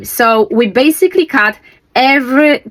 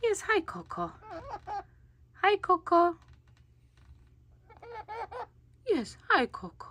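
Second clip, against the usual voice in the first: barely voiced, high little hello; hushed high greeting; 11 semitones higher, 18 dB quieter than usual. Real, not acted. Owner greeting Coco beside the sleeping brother, hushed and high. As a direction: greet softly so the sleeper stays asleep.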